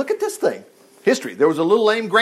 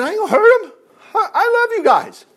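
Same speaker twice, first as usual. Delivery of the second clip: much higher, earnest David voice; voicing David; 14 semitones higher, 4 dB louder than usual. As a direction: lift the voice high as young David promising mercy. Preacher voices David's promise.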